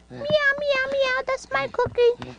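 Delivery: high meowing cat voice; kitty voice. Pretend. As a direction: squeak it like a playful kitten. Voicing the cat toy.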